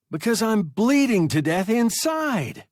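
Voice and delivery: high, loud hurt wail; whiny hurt boy. A narrator's reading. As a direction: wail it desperately. Boy hysterical.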